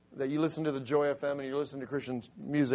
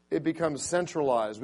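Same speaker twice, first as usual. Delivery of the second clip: ordinary talk, nothing marked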